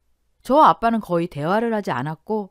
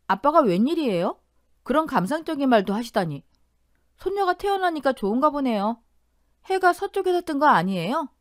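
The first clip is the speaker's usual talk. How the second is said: higher, playful teasing disbelief; teasing daughter; 6 semitones higher than usual. Retold or imitated. Daughter teasing her father at the hospital, playful disbelief. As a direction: needle dad about his sudden softness.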